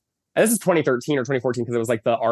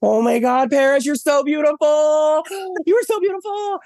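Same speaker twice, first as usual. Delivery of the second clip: much higher, louder, breathless fangirl gush with a gasp; starstruck gushing; 3 dB louder than usual. Retold or imitated. Fan in drag gushing at Paris Hilton, starstruck and squealing. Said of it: "it's literally just me being like".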